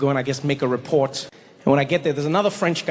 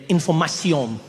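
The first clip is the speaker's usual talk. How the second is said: INFORMATION said the French way, ending in a nasal "-syon"; French pronunciation. Shown as a demonstration. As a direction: read "Information" as a French word. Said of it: "And in French".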